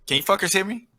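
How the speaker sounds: high, exasperated question; irritated check. Real, not acted. Podcast host asking bored cohosts if they hear him. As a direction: demand they pay attention.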